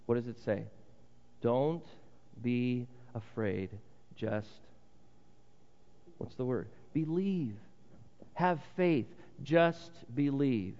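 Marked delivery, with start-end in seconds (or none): 1.4-10.8 gentle reassurance. Acted. Jesus comforts the grieving father.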